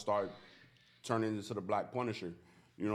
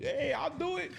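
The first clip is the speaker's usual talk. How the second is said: eager high voice. Retold or imitated. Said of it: "trying to be like".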